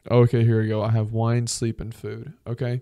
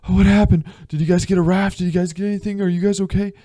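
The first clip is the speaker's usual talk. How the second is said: anxious questions; 7 semitones higher, 5 dB louder, faster than usual. Pretend. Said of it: "and you're like".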